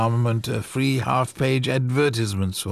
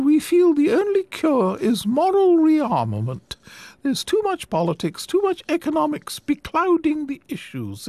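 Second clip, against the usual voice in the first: put-on high, earnest old lady voice, fervent; a gentle old woman preaching her cause; sweet old lady. Old lady preaching moral rearmament.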